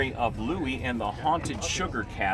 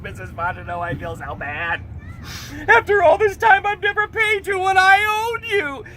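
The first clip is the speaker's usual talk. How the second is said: mock crying; 7 dB louder than usual. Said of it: "and he was crying".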